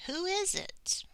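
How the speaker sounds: WHO lifted high in a questioning tune; asking a question. Acted. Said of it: "Sam is asking a question".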